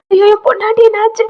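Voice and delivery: high, loud alarmed wail; acted frantic yell; panicked shout. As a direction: cry out in terror for a wife. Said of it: "அவன் கத்துறான்".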